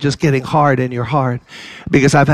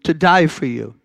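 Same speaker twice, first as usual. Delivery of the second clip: ordinary talk, nothing marked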